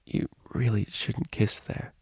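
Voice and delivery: slow unvoiced whisper; shaky whisper. A narrator's reading. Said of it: "Vinyl whispered shakily".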